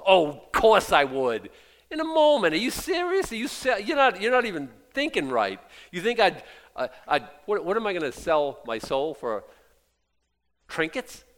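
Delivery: loud, high, fired-up preaching; fiery preaching. Acted. Pastor refusing a million-dollar check, fiery preaching. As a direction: refuse the money with full fire.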